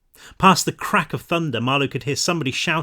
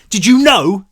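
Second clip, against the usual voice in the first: louder, higher accusing question; angry demand; 6 semitones higher, 10 dB louder than usual. A narrator's reading. Pan confronts Herc about Ostheim, angry demand. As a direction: demand the truth, teeth bared.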